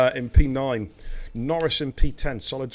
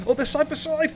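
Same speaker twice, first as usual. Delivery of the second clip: excited; 13 semitones higher, 4 dB louder than usual. Real, not acted. Repeated excited call.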